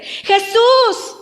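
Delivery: high, loud repeated yell; insistent full-voiced call. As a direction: keep crying out, refusing to be silenced. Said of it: "dice la Biblia que gritaba más fuerte".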